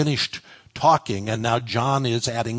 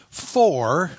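"For" punched high and drawn out; "For" as a big emphasized word on its own; stress on FOR; 4 semitones higher, 3 dB louder than usual. Shown as a demonstration. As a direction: hit "For" hard and let it hang. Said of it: "put the emphasis of the verse".

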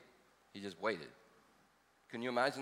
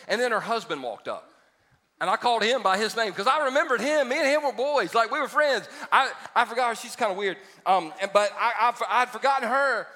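higher and louder, building up laughs; excited storytelling; 6 semitones higher, 5 dB louder than usual. Real, not acted. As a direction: get fired up telling the story. Preacher getting louder with a funny story.

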